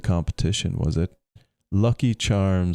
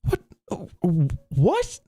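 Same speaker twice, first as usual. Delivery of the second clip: stunned what, then a far higher one; flabbergasted; 12 semitones higher than usual. A narrator's reading. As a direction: leap up high in disbelief on the repeat.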